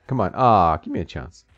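ordinary talk, nothing marked

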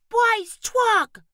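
put-on high toddler wail; small child wailing. A narrator's reading. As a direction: wail it like a toddler losing his toy. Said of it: "Bryce wailed".

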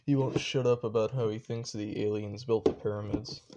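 usual speaking voice

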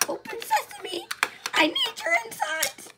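very high, strained squeak; odd, high-pitched play voice; not-okay squeaky voice. Pretend. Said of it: "That sounded not okay".

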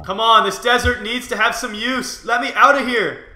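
loud, high frustrated shout; frustrated yelling. Real, not acted. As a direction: yell at the game in frustration. Frustrated at desert in game.

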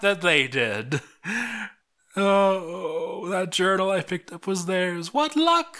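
high, strained, half-crying; hysterical. Acted. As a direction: voice cracking with despair. Desperate journal entry, hysteria.

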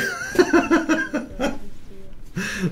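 long, high cackle of delight; high, delighted laughing fit; gleeful laughter. Real, not acted. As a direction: laugh helplessly after a big win. Laughs after the big win.

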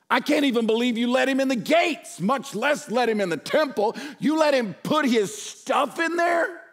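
furious exasperated yelling; outraged shout. Retold or imitated. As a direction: blast them like a betrayed leader. Pastor acts out Nehemiah's outrage.

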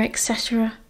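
trailing off almost inaudibly; fading to nothing. A narrator's reading. Sirius trails off mocking the family motto, fading. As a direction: let it die in a sigh.

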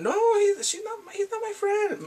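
high-pitched, stumbling protest; hammed-up indignant objection; mock flustered denial. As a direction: blurt the denial high, tripping over it.

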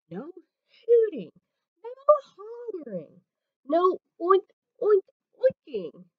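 usual speaking voice

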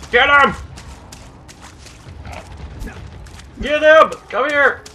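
very high, loud frantic yelling; shouting. Real, not acted. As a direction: scream at the fleeing tapir. Chasing the tapir.

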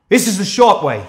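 This is the short way shouted loud and high; yelled. Retold or imitated. Yelling to the woman fleeing through the bush, loud shout. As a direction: yell This is the short way into the dark. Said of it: "I'm yelling".

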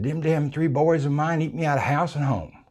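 plain reading voice

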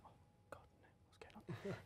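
hushed phone-call whisper; barely audible imitation of a man dreading the call; imitating a dreading husband. Tutor answering his angry wife's call, dreading whisper. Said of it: "look of a man whose wife is ringing him angry".

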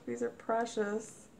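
normal talking voice

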